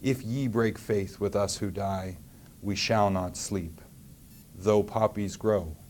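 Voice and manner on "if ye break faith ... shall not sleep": ordinary delivery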